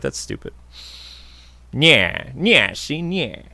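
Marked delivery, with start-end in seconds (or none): 1.7-3.5 put-on old gangster. Old-time gangster impression.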